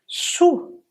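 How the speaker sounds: "Sou" with a rounded oo vowel; high-pitched model "Sou" with oo; correct OU vowel. Shown as a demonstration. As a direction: say "Sou" like French sous. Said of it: "c'est sou".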